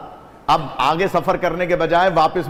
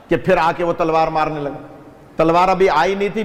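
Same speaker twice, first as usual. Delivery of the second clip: ordinary talk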